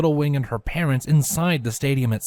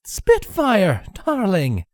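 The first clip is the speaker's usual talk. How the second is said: put-on old mare voice; 8 semitones higher, 3 dB louder, much slower than usual. Aged mare greets daughter.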